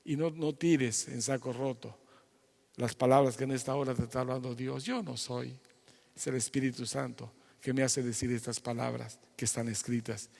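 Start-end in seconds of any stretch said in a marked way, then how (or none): none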